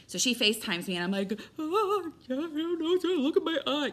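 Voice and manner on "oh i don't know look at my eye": high, tearful voice, much higher and louder; reliving the panic, voice up and breaking with tears; panicked crying. Retold or imitated. To sister-in-law on FaceTime about her eye, tearful and high. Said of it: "I don't know why I'm crying".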